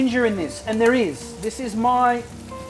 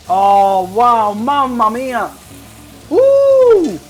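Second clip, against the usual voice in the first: loud delighted mamma mia; excited; 2 semitones higher, 8 dB louder, much slower than usual. Real, not acted. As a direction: burst out with joy. Tasting, excited exclamation.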